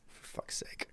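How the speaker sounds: exasperated whisper under the breath; whispered curse. Real, not acted. Streamer annoyed at the game, whispering a curse.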